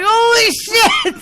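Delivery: high, loud, drawn-out panic; mock startled cat. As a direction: act out a cat's alarmed cry. Voices a startled cat in story.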